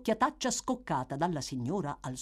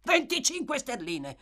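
higher, jolted repeat of the sum; startled exclamation; 10 semitones higher than usual. A narrator's reading. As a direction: react with a start. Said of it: "esclamò Monks trasalendo".

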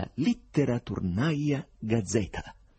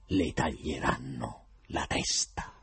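usual speaking voice

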